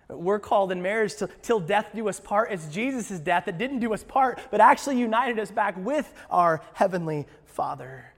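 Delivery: loud, rising preacher's build; preaching at full force. Acted. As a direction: drive it home to the congregation.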